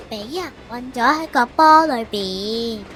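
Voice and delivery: ordinary delivery, nothing marked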